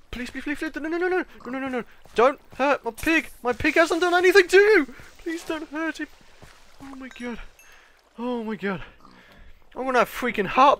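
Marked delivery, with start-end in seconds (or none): 0.1-1.8 soft, high rapid-fire begging; panicked begging. Real, not acted. 2.1-4.9 high, desperate protest for the pig; plead, rising higher as you go; desperate plea. Real, not acted. Defending pig from creeper. 5.2-6.1 high, soft little plea; whimpering plea. Real, not acted. Begging for pig's life. 6.8-8.8 hushed, stunned reaction. Real, not acted. Aftermath of second scare.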